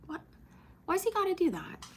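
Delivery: very high, melting pet-lover voice; cooing over dog. Real, not acted. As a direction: coo in a squeaky-high pitch. Cooing about affectionate dog.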